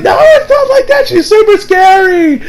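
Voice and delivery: very high, squealed mock panic; hammed-up high-pitched pleading; flustered denial. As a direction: imitate a frantic boy protesting, voice shooting up.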